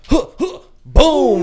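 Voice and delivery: loud, high grunts then a boom; hammed-up wrestling slam sound; takedown noises. Acting out the takedown.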